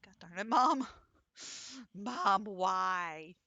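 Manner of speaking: loud, exasperated mock whine to mom; exasperated protest. Teased kid complaining to mom, mock exasperated. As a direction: protest loudly, imitating a fed-up kid.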